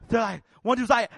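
usual speaking voice

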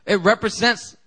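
high, loud, emphatic preaching. Acted. Preacher drives home the point to the congregation, loud and high.

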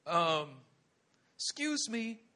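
approach meekly, afraid to ask; sheepish, halting request; timid Elijah. Acted. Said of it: "Listen to what Elijah says".

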